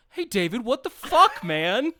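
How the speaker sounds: high, loud indignant cry of betrayal; hammed-up shout of disbelief; mock outraged yell. Craig yelling at David before being shot, mock outraged. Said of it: "delivers this great line".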